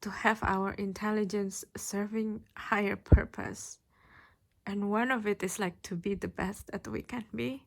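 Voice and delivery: pushing through a lump in the throat; emotional. Real, not acted. Tarot reader moved while describing unconditional love, choked up. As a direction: voice catching with sudden emotion. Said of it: "I don't know why I'm so emotional all of a sudden".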